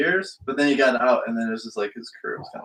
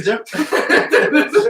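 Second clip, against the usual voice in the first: laughing; 11 semitones higher, 4 dB louder, much slower than usual. Real, not acted. Laughing repeat of own word.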